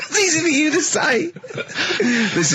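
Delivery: high, laughing through the words; giggling while teasing; laughing at a friend's struggle. Real, not acted. Laughing at Carl.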